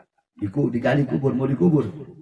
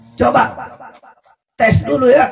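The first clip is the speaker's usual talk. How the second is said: tantangan keras; 10 semitones higher, 9 dB louder than usual. Retold or imitated. Ahli ibadah menantang nafsu.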